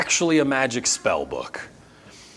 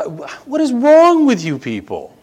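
high, drawn-out exasperated outburst; appalled disbelief, pitch leaping up; 9 semitones higher, 3 dB louder than usual. Acted. Preacher rebuking people who cut themselves for gods, exasperated. Mock rebuke of idol worshippers.

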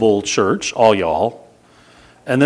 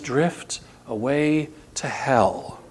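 slow whispered dread; slow hushed warning about hell; ominous whisper; 7 dB quieter, much slower than usual. Acted. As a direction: let the warning drop to a whisper. Preacher's grave warning.

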